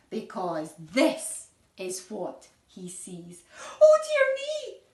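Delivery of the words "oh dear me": high, loud mock dismay; alarmed cry. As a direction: gasp it out as if shocked for the kids. Narrator alarmed at what Tim sees.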